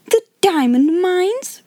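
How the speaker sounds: high, drawn-out, disdainful echo; scornful outburst. A narrator's reading. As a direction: throw the words back in indignant disbelief. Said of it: "broke out Lavinia".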